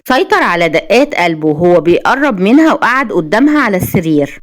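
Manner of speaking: ordinary delivery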